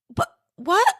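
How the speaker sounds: high, halting little voice; stunned disbelief. Retold or imitated. Little chef told he's canceled, imitated stunned disbelief. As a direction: imitate a small crushed character stammering in shock. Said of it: "and then he's like".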